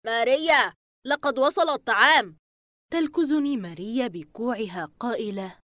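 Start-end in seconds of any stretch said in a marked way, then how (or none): 0.0-2.4 louder, raised call. A narrator's reading. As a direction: call to her from outside. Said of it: "أسمع صياح يوسف من الخارج".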